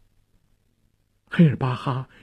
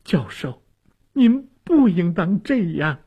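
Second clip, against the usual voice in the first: high, pained appeal; pained reproach to a dying man; anguished; 8 semitones higher than usual. A narrator's reading. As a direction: grieve over him, pitch rising high on the second phrase. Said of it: "痛苦地说".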